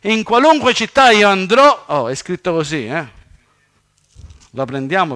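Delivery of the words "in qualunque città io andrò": louder, raised cry of a fugitive; retold cry to God. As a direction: call it out loud and high in protest. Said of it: "Caino grida a Dio e dice".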